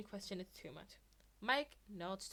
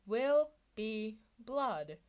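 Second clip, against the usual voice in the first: slow, drawn-out dark vow; mock menace, savour each word; very slow and louder; ominous threat; 8 dB louder, much slower than usual. A narrator's reading. Mike threatening.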